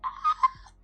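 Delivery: breathless high giggle close to tears; laugh-cry of pure joy; high teary laugh. Real, not acted. Unboxing dream gym book.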